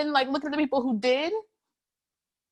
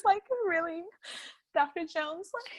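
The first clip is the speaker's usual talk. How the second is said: ordinary delivery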